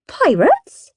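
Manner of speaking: high, rising query; mum's incredulous question. A narrator's reading. Mum questions the children, high incredulous voice. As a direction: ask it high in puzzled surprise. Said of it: "said Mum".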